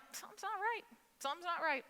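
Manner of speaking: high, softened retold worry; quietly uneasy inner voice; uneasy murmur. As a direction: say it high and hushed, as if just noticing trouble. Waking up alarmed, retold.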